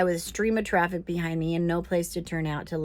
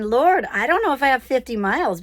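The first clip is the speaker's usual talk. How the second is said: worried prayer; 5 semitones higher, 5 dB louder than usual. Retold or imitated. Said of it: "So I'm thinking".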